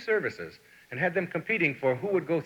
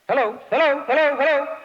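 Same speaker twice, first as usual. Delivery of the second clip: much higher and louder, calling into the line; mock phone hello; 12 semitones higher, 7 dB louder than usual. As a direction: pretend the line went dead.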